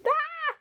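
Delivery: very high squeal of joy; excited squeal. Real, not acted. To viewers, a very high excited squeal. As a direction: squeal at the package.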